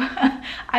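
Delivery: breathy embarrassed giggle; a quick bashful laugh; little nervous laugh, high and airy; short high laugh. Real, not acted. Embarrassed about her English.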